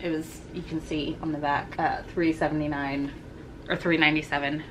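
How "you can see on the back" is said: ordinary talk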